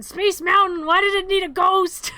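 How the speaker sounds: a hammed-up exasperated yell; mock outraged shout. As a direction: demand it of the ride in fake fury. Mock outrage at a Disney ride.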